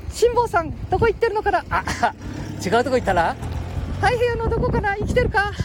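put-on high squeaky boy voice; Ken-chan voice.

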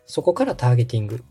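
ordinary talk, nothing marked

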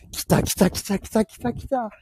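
high-pitched, drawn-out excited chant; getting carried away. Real, not acted. Livestreamer feeling the telepathic luck arrive, excited. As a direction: get worked up, pitch jumping way up. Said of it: "ちょっと声でかくなってきたわ".

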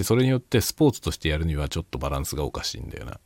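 normal talking voice

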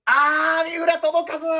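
loud, high, breathless commentary shout; commentary style. Shown as a demonstration. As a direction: call it like a live sports announcer. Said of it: "もうちょっと実況風に".

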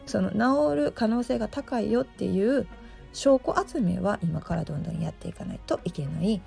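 normal talking voice

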